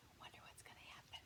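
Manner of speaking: hushed suspense whisper. Real, not acted. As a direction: lean in and whisper the question quickly. Aside to listening children.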